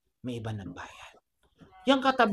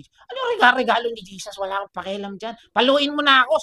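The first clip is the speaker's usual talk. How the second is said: put-on stubborn kid with loud, high bursts; defiant child; 4 semitones higher, 6 dB louder than usual. Child daring the teacher to spank, defiantly. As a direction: act out a hard-headed child talking back. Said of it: "sabi ng bata".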